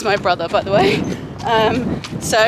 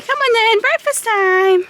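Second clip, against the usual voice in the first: high pet call; 11 semitones higher than usual. Real, not acted. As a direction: coax the horse in like a fond owner calling a pet. Calling horse in for breakfast.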